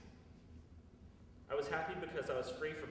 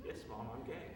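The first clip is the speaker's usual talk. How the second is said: calm repeat; 2 semitones lower, 5 dB quieter than usual. Retold or imitated. Calm reaffirmation after outburst.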